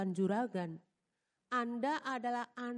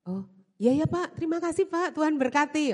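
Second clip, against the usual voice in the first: sheepish reply; 4 semitones higher, 6 dB louder than usual. Retold or imitated. Her own sheepish reply retold.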